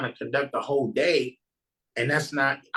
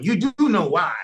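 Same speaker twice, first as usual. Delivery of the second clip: knowing minister; 10 semitones higher, slower than usual. Retold or imitated. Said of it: "That's first line number one".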